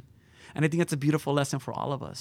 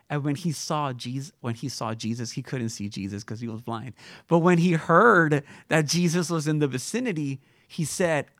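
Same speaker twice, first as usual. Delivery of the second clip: usual speaking voice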